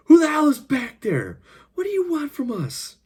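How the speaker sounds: high, strained shouted questions; alarmed demand. A narrator's reading. Bound captive to footsteps behind him, alarmed high cry.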